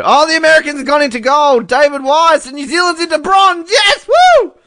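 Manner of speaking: high, loud cheer at a live result; excited outburst. Real, not acted. Host reacting live to a New Zealand bronze, excited outburst. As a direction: shriek the news, building to a whooping yes.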